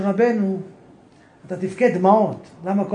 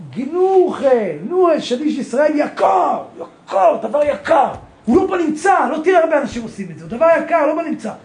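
impassioned preaching; 5 semitones higher than usual. Real, not acted. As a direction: cry out how precious it is.

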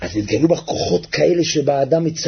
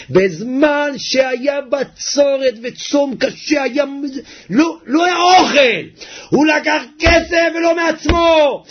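fervent sermon shout; preaching at full force; 11 semitones higher than usual. Acted. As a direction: drive the story home to the crowd.

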